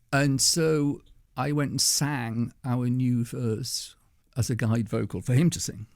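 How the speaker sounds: ordinary delivery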